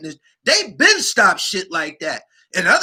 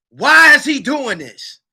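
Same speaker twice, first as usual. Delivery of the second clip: louder, slow, higher demand; emphatic repeat; 6 semitones higher, 6 dB louder than usual. Real, not acted. Asking the audience why, loud and slow. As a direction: lean into the why.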